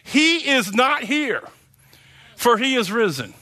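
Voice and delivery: each word of "He is not here" drawn out slowly, then settling down on "for he is risen"; slow reverent reread of the verse; slow and deliberate. Shown as a demonstration. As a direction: let the words sink in slowly, savouring them. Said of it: "Think about that".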